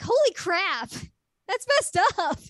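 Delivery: high, loud disbelieving cry; shocked outburst. Real, not acted. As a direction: blurt it out in real shock. Speaker reacts to story.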